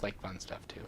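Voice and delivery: whispered under the breath; a quick joking whisper. Real, not acted. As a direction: slip it in as a hushed aside. Joking aside about social media.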